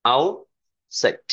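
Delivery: slow, drawn out. Shown as a demonstration. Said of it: "teacher models the word again, slowly".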